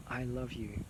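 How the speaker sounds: a quiet heartfelt I love you; tender hush. Real, not acted. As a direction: let it fall to a tender near-hush. Softer repeat of the same words.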